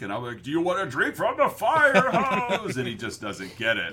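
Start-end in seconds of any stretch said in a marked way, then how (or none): 0.4-2.8 high, hammed-up movie-quote yell; mock movie shout. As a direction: holler it like a goofy film character. Acting out his UHF quote to son.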